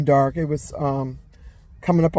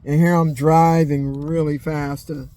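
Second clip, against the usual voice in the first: usual speaking voice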